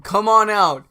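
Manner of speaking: big host's introduction shout; calling out. Pretend. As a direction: pretend to summon someone from backstage. Calling imaginary guest on stage.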